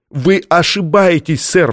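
high, urgent outcry; crying out. A narrator's reading. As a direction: protest sharply and high. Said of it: "вскричал Чатрукян".

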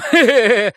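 loud-ish high cackling laugh; acted guffaw. A narrator's reading. Bobby laughs alone at his joke, guffawing. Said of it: "расхохотался".

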